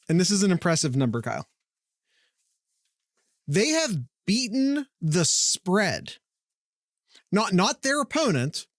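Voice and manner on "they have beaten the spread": slow, weighty delivery; slow emphatic. Real, not acted. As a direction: lean on each word slowly.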